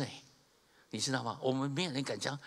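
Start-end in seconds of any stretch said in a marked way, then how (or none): none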